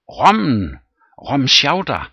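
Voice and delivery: eager loud reply. Acted. Captain answering the old gentleman, loud and eager. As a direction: call the name out eagerly.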